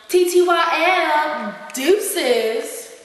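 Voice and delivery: high mock teen slang; imitating knuckleheads. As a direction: mimic an overexcited texting kid. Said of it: "these knuckleheads today running around screaming".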